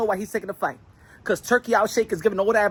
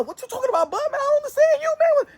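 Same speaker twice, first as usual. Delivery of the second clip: squeaky baffled much higher voice; confused high voice. Pretend. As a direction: sound lost and baffled, pitched way up. Confused voice answering in English.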